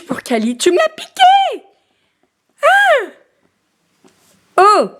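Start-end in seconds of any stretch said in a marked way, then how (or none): 0.6-1.6 high squeaky playful accusation. Real, not acted. Playful teasing of the baby. 2.6-4.9 mock surprise. Real, not acted. Playful exclamations to baby.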